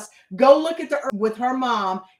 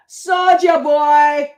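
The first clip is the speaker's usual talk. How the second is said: loud, drawn-out hype holler; shouted name; 4 semitones higher, 10 dB louder, much slower than usual. Acted. Saying she is here for it, playful shouted name. Calls out rapper's name excitedly.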